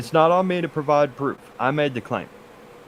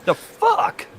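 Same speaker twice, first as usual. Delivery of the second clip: put-on shrill-ish offended old poet, much higher and louder; indignant high voice; 4 dB louder than usual. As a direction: play a scandalised ancient poet, high and loud. Playing offended Homer.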